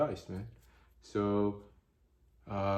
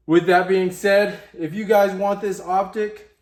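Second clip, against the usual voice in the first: loud, high, slowed build-up; excited announcement; 9 semitones higher, 10 dB louder than usual. Real, not acted. Vlogger offering the optic to viewers, loud and high. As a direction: announce it big.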